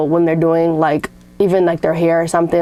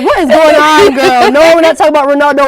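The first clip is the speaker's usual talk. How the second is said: loud mock; 7 semitones higher, 7 dB louder than usual. Mocking soccer fan, loud and high.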